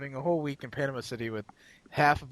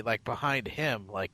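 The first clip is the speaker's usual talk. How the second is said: ordinary talk, nothing marked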